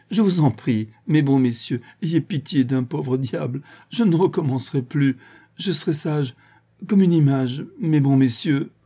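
high, loud, hammed-up begging; mock pleading old man. A narrator's reading. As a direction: beg for mercy while laughing inside. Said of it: "demandait grâce en riant". (fausse supplication rieuse)

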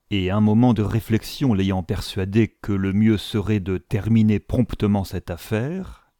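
usual speaking voice

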